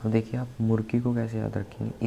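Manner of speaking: ordinary delivery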